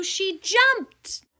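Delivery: big, loud rising burst; thrilled storyteller punching the jump; excited. A narrator's reading. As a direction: shout the leap with excitement. Climax of the jump.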